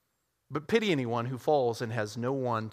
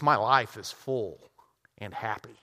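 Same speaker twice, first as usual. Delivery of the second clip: content fisherman; 4 semitones lower than usual. Acted.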